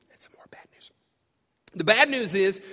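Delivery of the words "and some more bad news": whispered, almost voiceless; whispered aside. Real, not acted. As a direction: let it slip in a whisper. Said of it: "whispered aside".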